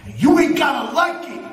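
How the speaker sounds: loud, high, fast threat. Acted. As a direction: punch it out as a warning. Promo threat, raised.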